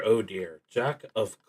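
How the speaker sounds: usual speaking voice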